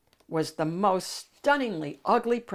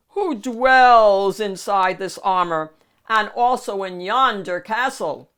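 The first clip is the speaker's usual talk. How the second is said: demanding; 2 semitones higher, 10 dB louder than usual. Acted. Shrek knocking on the armor, loud demand. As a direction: boom out the challenge.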